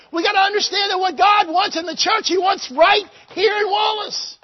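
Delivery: high, loud revival shout; full-force preaching. Acted. Preacher exhorting the church in Wallace, full-force shouted preaching. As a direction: push every line home with rising fervour.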